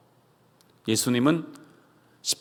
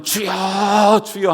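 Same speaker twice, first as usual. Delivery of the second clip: 주여 stretched very slow and raised; drawn-out prayer; 6 semitones higher, much slower than usual. Retold or imitated. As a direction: linger piously on each 주여. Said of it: "입술로는". (아주 느리게 늘이는 주여)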